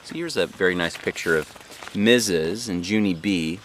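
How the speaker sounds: ordinary delivery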